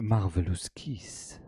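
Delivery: put-on slow French-accented purr. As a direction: savor each word, very French. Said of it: "give it a French accent".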